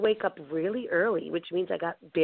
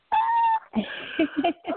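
chillido de risa muy agudo; ríe con un chillido agudo; high squealing laugh. Real, not acted. Squeals after Super Bowl story.

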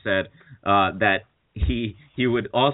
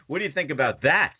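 ordinary delivery

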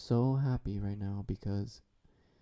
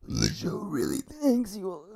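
higher, choked-up put-on voice; hammed-up tearful thanks; mock emotional; 8 semitones higher than usual. Thanking the fans with mock emotion. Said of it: "I just got so emotional".

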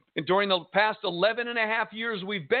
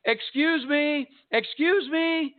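high, drawn-out call after someone; imitated shout; 6 semitones higher, slower than usual. Woman behind us in the parking lot, imitated shouting. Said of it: "behind us is shouting at us".

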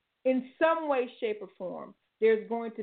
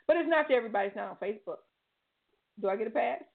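ordinary talk

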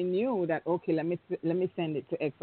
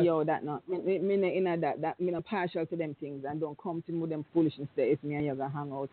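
slips into Jamaican patois to quote a friend, a bit lower; 3 semitones lower than usual. Retold or imitated. Telling his brethren he wants no foolishness, in Jamaican patois. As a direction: brush it off the way the brethren talk, in patois, quickening at the end. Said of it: "and say".

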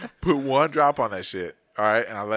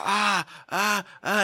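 higher, repeated cries of burning; re-enacted pained "ah"; 8 semitones higher, much slower than usual. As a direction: yelp from a burning mouth. Re-enacting pain from the pepper.

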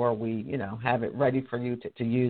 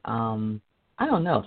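ordinary delivery

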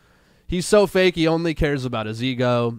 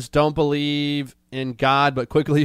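normal talking voice

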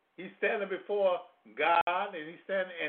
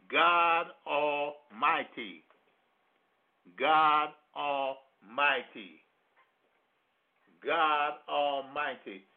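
slow solemn; 2 semitones lower, much slower than usual. Acted. Solemn repeated name of God.

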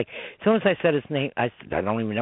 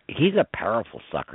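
normal talking voice

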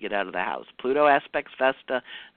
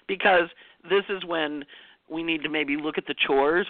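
usual speaking voice

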